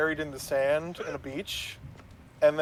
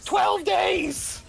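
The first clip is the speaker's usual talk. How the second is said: very high, shouted put-on voice; voiced game character; 14 semitones higher than usual. Game character guessing how long his kind lives, high shout. Graw's acted reply in retold scene.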